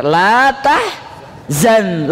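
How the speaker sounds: "La tahzan" stretched very slow, each syllable held; drawn-out la tahzan. Shown as a demonstration. Preacher savouring the famous phrase for listeners, very slow. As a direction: lean on "La tahzan" slowly like a motto. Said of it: "Kata-kata yang sangat populer".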